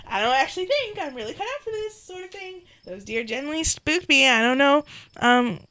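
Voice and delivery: timid player character. Acted. Voices the reluctant player character.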